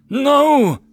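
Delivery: very high, loud anguished cry; frightened roar. A narrator's reading. Dragon seeing his partner fall, anguished roar. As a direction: cry out in horror as she falls. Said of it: "roared out in frightened anger".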